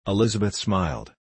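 usual speaking voice

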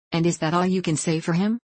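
usual speaking voice

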